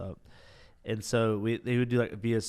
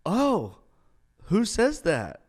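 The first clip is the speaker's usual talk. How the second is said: challenging; 10 semitones higher, 7 dB louder, much slower than usual. Retold or imitated. Challenging cadets.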